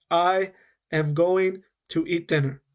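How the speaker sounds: slow, fully pronounced "I am going to eat dinner"; careful teacher's enunciation, no linking. Shown as a demonstration. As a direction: keep every word separate and clear. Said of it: "It's very slow, and I'm saying every sound".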